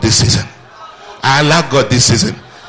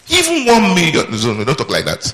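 ordinary talk, nothing marked

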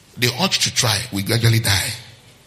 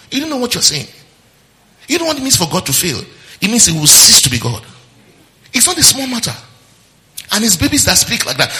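higher and louder, pressing the point; preaching rebuke; 9 semitones higher, 4 dB louder than usual. Real, not acted. Pastor rebuking believers who blame God, loud. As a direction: rise up and rebuke with force. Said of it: "preaching rebuke".

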